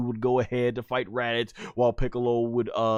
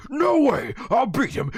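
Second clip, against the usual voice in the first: stubborn Vegeta; 10 semitones higher than usual. Retold or imitated. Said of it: "Vegeta's like".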